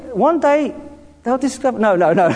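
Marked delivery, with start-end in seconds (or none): none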